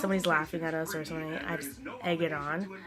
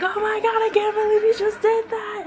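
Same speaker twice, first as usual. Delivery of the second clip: very high, loud squeal; excited mock shriek; 8 dB louder than usual. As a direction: hype her up like shrieking friends. Imitating friends hyping her up.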